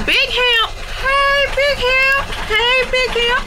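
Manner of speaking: high, sing-song call of a viewer's name; excited greeting. Real, not acted. Streamer greeting a regular viewer by nickname, high excited call.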